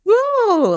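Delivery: high, delighted "woo"; excited whoop. Real, not acted. Co-host cheering a quotable line, excited whoop.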